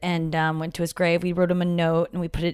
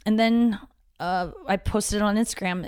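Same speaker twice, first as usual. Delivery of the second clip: usual speaking voice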